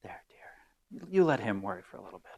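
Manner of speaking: soft hush to the husband; whispered comfort. Retold or imitated. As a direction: whisper it to her husband, soothing. Wife soothes husband after slamming.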